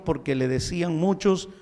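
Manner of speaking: ordinary talk, nothing marked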